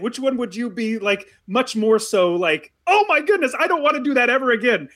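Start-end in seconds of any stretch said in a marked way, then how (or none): 2.9-4.9 put-on high, hurried frightened-kid voice; little kid. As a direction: react like a scared little kid. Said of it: "like, as a little kid".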